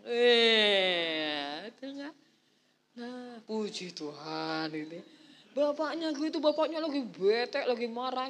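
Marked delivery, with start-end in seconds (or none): none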